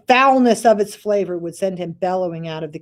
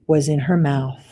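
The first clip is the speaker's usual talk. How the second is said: ordinary delivery, nothing marked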